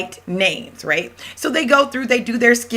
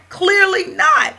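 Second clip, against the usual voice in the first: much higher, slow "clearly not"; emphatic dismissal; 12 semitones higher, 4 dB louder, slower than usual. Real, not acted. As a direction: stretch it out like it's obvious. Answering her own rhetorical question.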